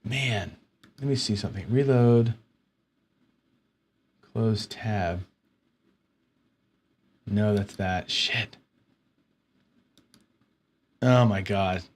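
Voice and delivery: soft, low searching aloud; dejected self-talk. Real, not acted. Streamer hunting for a lost file, soft and dejected. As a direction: talk quietly to yourself, deflated.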